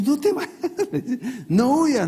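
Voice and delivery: high-pitched, laughing plea to a runaway; mock calling back. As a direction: comically call after someone fleeing. Dios llama a Moisés que huye. (llamada aguda y risueña al que huye)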